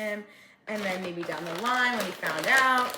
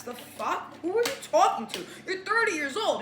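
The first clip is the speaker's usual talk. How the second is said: high, louder, fast outraged rant; indignant outburst; 9 semitones higher, 3 dB louder than usual. Real, not acted. Ranting at Curtis's friend with high, loud outrage. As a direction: tear into him for coming at her.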